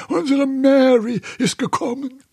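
put-on high, loud old man's delight ending in laughter; breathless laughter. A narrator's reading. Two old men marvel at the coincidence, breathless laughter. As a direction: exclaim it high and dissolve into laughing. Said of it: "gerieten vor Lachen außer Atem".

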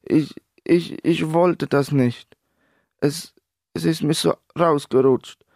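high, halting, faltering confession; hesitant, small voice pitched high; quiet stammer. A narrator's reading. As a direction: stammer it out slowly, shaken. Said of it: "Dieser stotterte ganz leise".

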